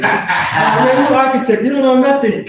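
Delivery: mock the know-it-all, laughing; cocky architect. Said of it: "he was all like".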